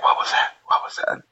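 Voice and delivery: hushed, breathless whisper; scared whisper. Retold or imitated. Boys in the dark woods whisper in fear. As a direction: whisper it like something's out there.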